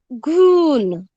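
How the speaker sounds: घून said loudly with the u held; clear model reading. Shown as a demonstration. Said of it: "घ को छोटी ऊ का उच्चारण लगाया है". (घून ज़ोर से, साफ़ बोलना)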